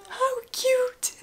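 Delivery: high squeal over something tiny; squealing coo. Real, not acted. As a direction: squeal it out in delight. Repeated coo, louder.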